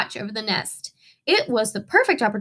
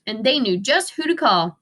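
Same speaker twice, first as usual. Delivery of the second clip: usual speaking voice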